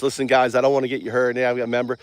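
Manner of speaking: hushed, fake-grave confession; mock serious. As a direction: act like it's a grave secret. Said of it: "like, all serious".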